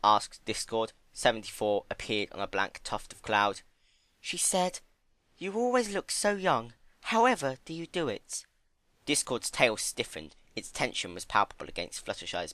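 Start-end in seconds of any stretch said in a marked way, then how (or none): 4.2-8.4 high, gentle pony voice; high timid pony. Acted. Fluttershy quoting Rarity.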